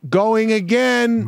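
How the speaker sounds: high, drawn-out announcement; mock director's call. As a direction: sing out the order to the whole set. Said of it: "you can't go "cut".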